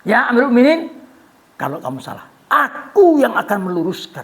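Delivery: loyal friend. Acted. Hudzaifah vows to Umar, loud and firm. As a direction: call out firmly, devoted. Hudzaifah voiced pledging to correct.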